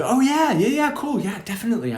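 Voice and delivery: high-pitched, eager phone yes, retold; acting out an enthusiastic yes; eager acceptance. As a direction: jump at the offer in a mock-eager high voice. Eagerly accepting well-paid phone offer.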